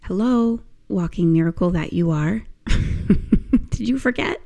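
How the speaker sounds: ordinary talk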